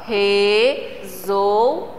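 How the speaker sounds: "resolveu" in three slow pieces, re, sol, veu; syllable separation. Shown as a demonstration. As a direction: pause between each syllable of "resolveu". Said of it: "Separação".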